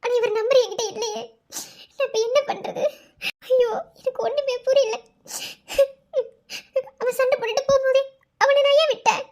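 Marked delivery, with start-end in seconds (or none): none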